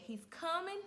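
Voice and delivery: high, loud urgent call; mother telling the nurse the baby is here, urgent and loud; urgent alarm. Retold or imitated. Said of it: "I said".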